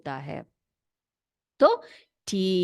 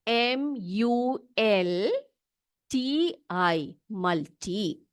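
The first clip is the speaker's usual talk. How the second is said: each letter spaced out, very slow; spelling out slowly; much slower than usual. Shown as a demonstration. Teacher spelling a word for her class, very slow. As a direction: spell it slowly so children can copy. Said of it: "spelling देख लो बच्चों".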